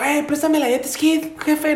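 mock young guy asking. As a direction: ask for the jet ski like a lazy young guy. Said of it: "llegó y dijo". (imitando a un chavo pidiendo prestado)

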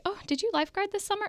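high, fast, chirpy question; roommate impression. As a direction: imitate a curious roommate. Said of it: "my roommate was like".

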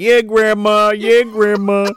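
high, loud crowd chant; mock cheering. College students egging grandma on at the mic, mock cheering. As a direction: cheer her on like a rowdy crowd. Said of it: "cheering her on".

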